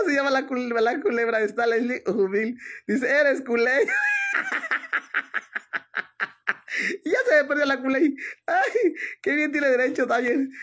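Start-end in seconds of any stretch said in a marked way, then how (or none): 3.8-7.0 high crying howl of laughter; shrieking laugh. Real, not acted. Woman howls laughing at the culé joke, shrieking laugh.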